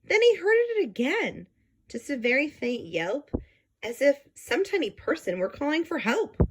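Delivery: put-on lively read-aloud for children; storybook reading. Teacher reading a picture book to the class, lively storytelling.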